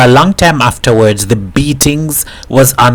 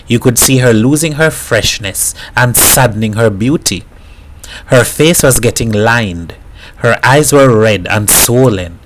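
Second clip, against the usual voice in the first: ordinary delivery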